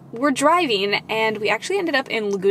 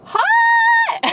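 high, loud yelp at the heat; hot food shout; 5 dB louder than usual. Real, not acted. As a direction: yelp as the food burns you.

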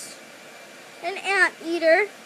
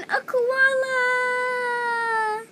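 drawn-out whine; 9 semitones higher, much slower than usual. Pretend. Stretched crying-like delivery.

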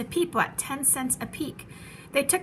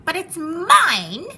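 high, loud, drawn-out protest; indignant bird screaming, stretching the words; possessive scream; 6 semitones higher, 8 dB louder, much slower than usual. A narrator's reading. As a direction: wail it out to claim it. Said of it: "screamed the bird".

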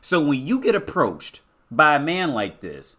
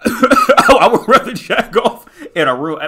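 laughing; 6 semitones higher, 9 dB louder, slower than usual. Real, not acted. Laughing through a rant.

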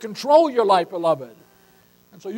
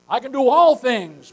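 all pushed louder and held; stress on all; 2 semitones higher, 6 dB louder than usual. Shown as a demonstration. Pastor preaching Paul's verse, stress on all. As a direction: hit all, not some. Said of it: "not some things".

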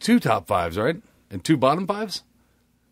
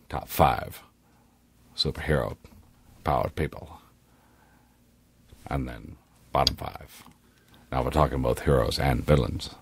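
Morgan Freeman impression; 13 semitones lower, 3 dB quieter than usual. Cohost introducing the episode as Morgan Freeman, much lower. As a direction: intone it like a film narrator. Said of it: "Morgan Freeman".

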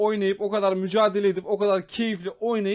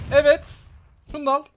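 excited shout; 7 semitones higher, 6 dB louder, faster than usual. Real, not acted. Sudden win moment.

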